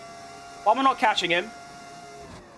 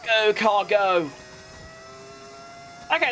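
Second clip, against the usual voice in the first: normal talking voice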